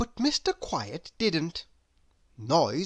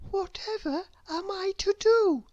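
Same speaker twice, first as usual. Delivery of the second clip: tiny, high voice of despair; despairing little thought; 14 semitones higher than usual. A narrator's reading. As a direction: think it aloud in a small, high, helpless voice. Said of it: "with a feeling of despair".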